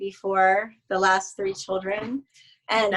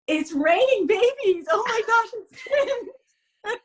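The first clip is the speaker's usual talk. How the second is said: much higher, squealing through laughter; giddy disbelief over twins, laughing; excited text read out; 12 semitones higher, 3 dB louder, slower than usual. Retold or imitated. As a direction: read the text like thrilled shock.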